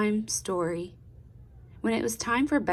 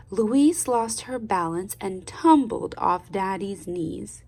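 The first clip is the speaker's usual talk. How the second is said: ordinary delivery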